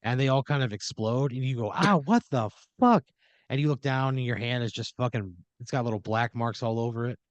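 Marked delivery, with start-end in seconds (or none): 1.7-3.0 startled cry. Pretend. Stars explode in your hand.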